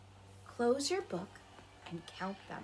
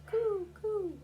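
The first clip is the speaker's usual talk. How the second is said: pigeon coo; 11 semitones higher than usual. Retold or imitated. Imitating the pigeons she hears, high coo. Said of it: "those pigeons".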